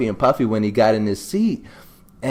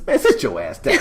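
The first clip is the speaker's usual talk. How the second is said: tough guy. Retold or imitated. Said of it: "sometimes you just need a".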